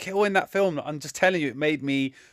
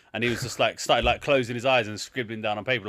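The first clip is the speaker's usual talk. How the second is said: ordinary talk, nothing marked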